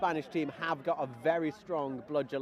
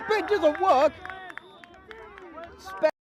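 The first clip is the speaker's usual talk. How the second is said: excited; 12 semitones higher, 6 dB louder than usual. Real, not acted. Sudden excited commentary burst.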